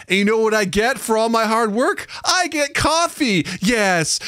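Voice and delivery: put-on high, sing-song talking to a child; excited kiddie-show lilt; baby talk. Shown as a demonstration. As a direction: pitch it up for a little one. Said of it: "it's baby talk".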